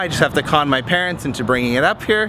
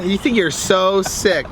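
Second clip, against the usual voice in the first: usual speaking voice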